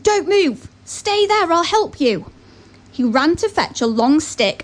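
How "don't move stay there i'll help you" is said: mild urgent shout, higher pitched. A narrator's reading. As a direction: call out higher to stop someone in danger. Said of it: "The farmer quickly shouted".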